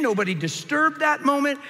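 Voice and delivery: normal talking voice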